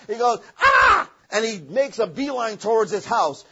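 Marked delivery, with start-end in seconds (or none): 0.6-1.1 sudden high, loud scream of terror; terrified scream. Acted. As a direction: shriek like a man who has just met a bear. Man sees bear, screams.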